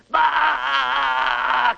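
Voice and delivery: put-on sudden high yelp of a screaming child; reenacting his son's shriek from the bathroom, high yelp; retold child scream. Son's scream in the bathroom, imitated as a high yelp. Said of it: "lets out a blood-curdling scream".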